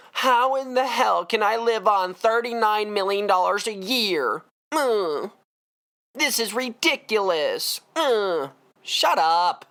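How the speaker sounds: mock a spoiled millionaire complaining about pay; mock superstar diva. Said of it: "I'm tired of these superstar divas".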